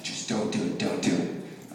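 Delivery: soft, low mock plea; hushed pleading. Child silently willing dad on stage, hushed pleading. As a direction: urge under your breath, acted.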